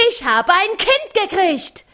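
"Kind" punched, high and falling; joyful shout. A narrator's reading. Mattes repeats his news, stressing "Kind", high and joyful. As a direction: land on "Kind" with delight. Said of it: "schrie dabei wie närrisch".

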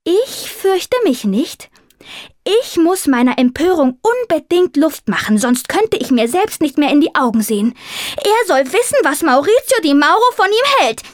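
high, fervent heroic declamation; impassioned high tomcat voice, swelling to a peak; wildly determined. A narrator's reading. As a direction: proclaim defiance like a little operatic hero. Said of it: "Augen glühten wild entschlossen".

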